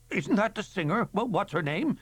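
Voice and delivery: lightly voiced high curious guest; a chatty onlooker asking. A guest in the crowd asking, light high voice.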